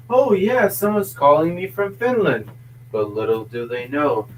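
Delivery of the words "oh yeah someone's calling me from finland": higher-pitched mock excited realisation; gullible excitement. Imagined call recipient falling for the Finnish robot, mock excited. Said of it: "'cause they're like".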